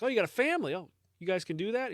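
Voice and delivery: high-pitched, curious astonishment; acted surprised question, pitch jumping up; mock surprise. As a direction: react as if this is news to you. Priest's surprise acted in joke.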